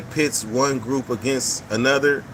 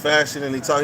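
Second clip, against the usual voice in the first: usual speaking voice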